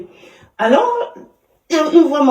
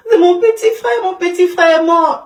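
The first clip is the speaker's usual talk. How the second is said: put-on crying child; 11 semitones higher, 8 dB louder than usual. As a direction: wail it like a sobbing son. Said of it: "Il dit".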